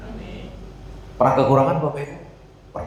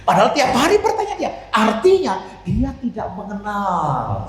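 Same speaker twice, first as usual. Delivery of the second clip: laughing; 8 semitones higher than usual. Real, not acted. Laughs at disciples' doubt.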